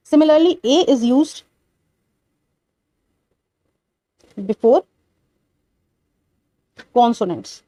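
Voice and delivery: slow, deliberate dictation; stretched-out teacher dictation. Shown as a demonstration. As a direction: spell the rule out for notes. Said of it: "dictating the third rule".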